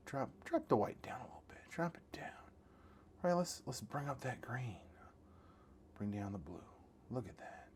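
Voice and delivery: whispering while fine-tuning the colours. Real, not acted. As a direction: hush it down to a whisper, as if not to disturb.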